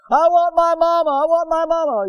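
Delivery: high, loud pleading whine; crying child. Retold or imitated. Preacher voicing boy with broken leg wanting mama. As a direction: wail it like a scared hurt boy.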